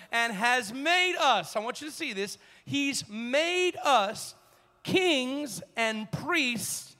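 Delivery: slow, weighty preacher's reading; emphatic scripture reading. Acted. Preacher shows congregation the key verse, slow emphatic reading. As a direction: draw out each phrase of the verse.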